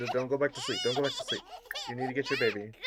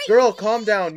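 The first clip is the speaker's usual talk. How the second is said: louder and higher, telling her off; loud scolding; 8 semitones higher, 13 dB louder than usual. Real, not acted. Gamer yelling at her Sim to calm down, loud and scolding. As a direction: snap her out of the panic.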